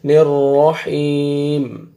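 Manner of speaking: رحیم with a long held ee; stretched haa. Shown as a demonstration. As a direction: draw out رحیم slowly after a heavy ra. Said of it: "ہا کو آپ نے کھینچنا ہے". (ہا کو کھینچ کر)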